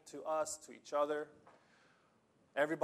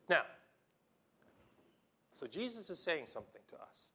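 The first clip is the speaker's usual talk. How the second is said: ordinary delivery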